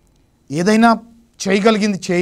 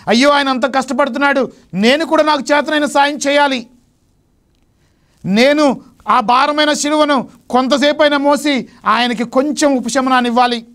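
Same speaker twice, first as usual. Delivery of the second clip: much higher and louder, earnest and urgent; eager resolve; 8 semitones higher, 4 dB louder than usual. Acted. Helper resolving to carry the cross for Jesus, high and urgent. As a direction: speak as someone who must help right now.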